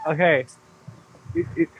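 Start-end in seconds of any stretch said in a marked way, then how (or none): 1.3-1.8 loud strained ape screech; rasping strained mutant. Acted. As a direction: screech like a zombie monkey. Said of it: "That was intense".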